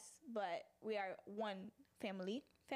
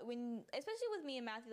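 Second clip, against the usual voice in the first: ordinary talk, nothing marked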